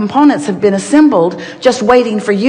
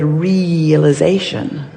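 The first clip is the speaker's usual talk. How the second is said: "realization" drawn out slowly, every syllable given weight; stressed word; 3 semitones lower, much slower than usual. Shown as a demonstration. Teacher stressing "realization" slowly for the audience. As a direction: stretch out "realization" so it sticks. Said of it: "we are really emphasizing the word".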